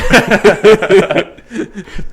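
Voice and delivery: cackling high-pitched laugh; loud high laugh. Real, not acted. Laughing at Charlie the Unicorn joke.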